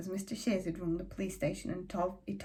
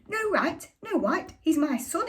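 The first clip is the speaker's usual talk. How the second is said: much higher, incredulous shouted repeat; outraged father; 8 semitones higher, 4 dB louder, slower than usual. A narrator's reading. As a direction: throw her words back, pitch jumping up. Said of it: "And Father shouted".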